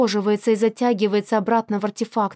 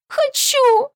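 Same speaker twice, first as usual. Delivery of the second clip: put-on high tearful child's shout; shouting through tears; 3 dB louder than usual. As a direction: cry it out like a sobbing little girl. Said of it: "кричит Николь сквозь слёзы".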